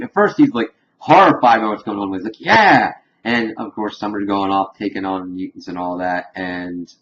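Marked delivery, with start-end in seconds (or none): 2.4-2.9 loud cheer jumping up in pitch; excited yell. Retold or imitated. Morty getting into the killing, excited yell. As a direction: let out a gleeful mock battle cry.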